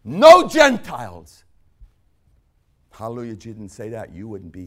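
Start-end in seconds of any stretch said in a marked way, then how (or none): 0.0-1.4 very high, loud and slow; shouted slow pronouncement; mock outraged decree. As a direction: bellow it as a mocking proclamation. Mock Jesus decree continued.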